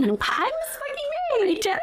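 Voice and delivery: proud brag. Retold or imitated. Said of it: "all proud".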